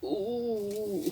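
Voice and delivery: ordinary delivery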